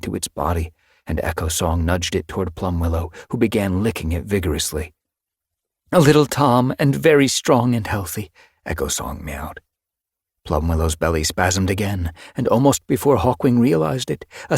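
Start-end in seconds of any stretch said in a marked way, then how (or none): 5.9-8.3 a higher, louder happy report; pleased announcement. Acted. Medicine cat announcing the newborn, higher and pleased. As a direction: announce the healthy kit with joy.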